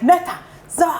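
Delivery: put-on called out high, like a dad summoning his kids; calling names. Father calling his kids to do chores, mildly imitated. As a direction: sing out each child's name.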